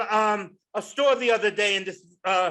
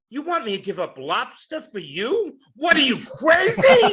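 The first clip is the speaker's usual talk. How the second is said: wife's indignant retort; 2 semitones higher, 5 dB louder than usual. Retold or imitated. Wife scolding her husband about giving up lobster, loud retort. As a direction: scoff at him like an appalled wife.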